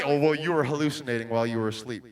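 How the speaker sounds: low, fast, brushing-off doctor; dismissive doctor. Retold or imitated. Preacher mocking doctors who called his vision a hallucination, low and quick. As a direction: rattle it off like a doctor dismissing you. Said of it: "these are the doctors that tell me".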